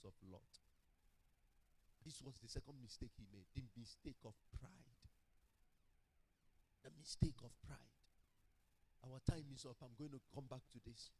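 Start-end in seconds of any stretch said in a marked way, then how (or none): none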